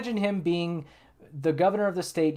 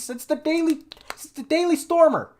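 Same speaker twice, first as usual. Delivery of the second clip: exasperated outburst; 11 semitones higher, 4 dB louder than usual. Real, not acted. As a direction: pitch jumps way up, louder, incredulous. Exasperated rant about race politics.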